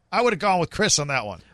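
normal talking voice

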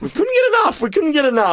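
excited. Acted. Excited radio banter, echoing cohost.

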